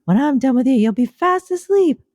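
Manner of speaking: a squeaky character promising calm; high silly relaxer voice. Pretend. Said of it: "I was doing the relaxing bit".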